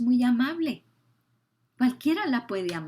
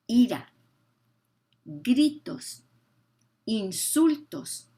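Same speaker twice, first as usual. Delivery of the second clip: emphatic list; 4 semitones higher, 7 dB louder, slower than usual. Acted. Preacher hammers sin list.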